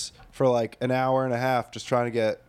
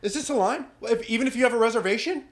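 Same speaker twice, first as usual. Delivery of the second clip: mock entitled dad; 9 semitones higher, faster than usual. Mocking angry dads in line.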